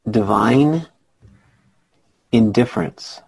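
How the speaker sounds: slow, each word set apart by a long pause; said slowly. Shown as a demonstration. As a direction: say it slowly, leaving space after DIVINE. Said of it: "I have to say it slowly".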